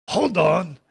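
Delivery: put-on drawn-out, heavy French accent; slow French friend savoring his recognition; Remy, very French. French friend stopping a woman he half recognizes, French accent. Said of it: "that is exactly how Remy sounds".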